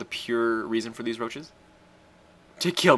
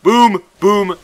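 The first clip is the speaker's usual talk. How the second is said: loud, much higher triumphant booms; hype booms; 11 semitones higher, 14 dB louder than usual. Real, not acted. Gamer cheering his attack, loud booms. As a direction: punch each boom out.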